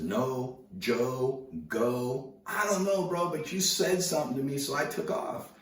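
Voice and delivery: high, loud self-defence; retold excited protest to a bro; protesting comeback. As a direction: argue back with pitch way up. Retelling his laughing defence to surfer.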